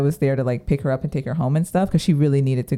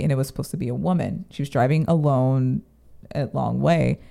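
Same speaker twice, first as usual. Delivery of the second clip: ordinary talk, nothing marked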